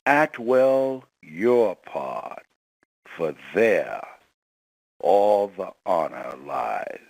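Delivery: slow, deliberate recitation; solemn quote. Acted. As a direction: intone the quote with weight. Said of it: "Mister Washington's favorite quote".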